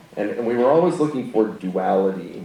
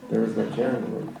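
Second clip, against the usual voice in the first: ordinary delivery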